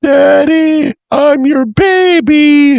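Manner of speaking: put-on very high, loud, drawn-out little-kid plea; hammed-up daughter pleading with daddy; mock wailing child. Mimics daughter back from timeout.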